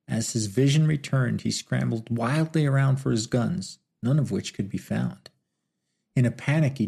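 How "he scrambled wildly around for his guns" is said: usual speaking voice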